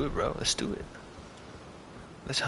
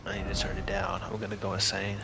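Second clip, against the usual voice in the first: whispering; 3 semitones lower, 6 dB quieter than usual. Real, not acted. Annoyed at game volume.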